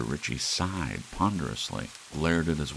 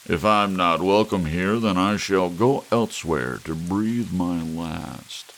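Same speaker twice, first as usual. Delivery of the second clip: louder, theatrical wounded dignity; melodramatic pomp; 3 semitones higher, 8 dB louder than usual. Acted. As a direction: ham up the tragic farewell. Drunk guest's melodramatic exit.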